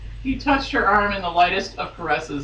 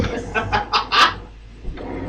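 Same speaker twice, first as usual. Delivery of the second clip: whispery laugh; 2 semitones higher than usual. Real, not acted. Laughs after Gollum voice.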